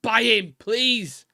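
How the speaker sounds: high, loud eager plea; excited. Retold or imitated. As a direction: beg for the signing with excitement. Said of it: "It just doesn't make me wanna go".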